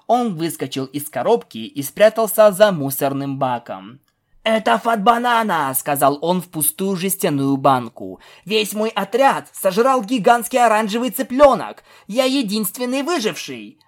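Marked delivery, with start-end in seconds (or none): 4.4-13.8 mock radio distress call.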